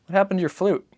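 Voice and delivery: put-on high, light child's voice; a small boy asking his father; boy's voice. Narrator voices the boy asking Papa, high and childlike.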